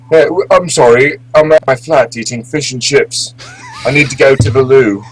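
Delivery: mock the Brits with a hammed-up English accent; mock British accent. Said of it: "who the fuck talks like that?".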